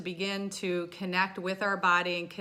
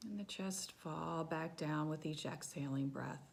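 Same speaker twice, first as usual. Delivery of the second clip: soft, hushed meditation voice; quiet, settling body-scan narration; guided meditation; 3 semitones lower, 6 dB quieter than usual. Real, not acted. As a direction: guide them gently through a body scan, softly and with long pauses.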